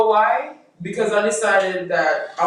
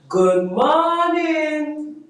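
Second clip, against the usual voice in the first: high, slow, sing-song good morning; radio morning greeting; 10 semitones higher, much slower than usual. Shown as a demonstration. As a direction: stretch it out like a morning host. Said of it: "You have to smile when you say it".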